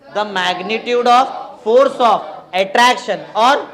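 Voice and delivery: loud, very slow, stretched-out chant; drawn-out syllables, left hanging for students to finish; class recitation. Real, not acted. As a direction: lead the class word by word. Leading class in repeating definition.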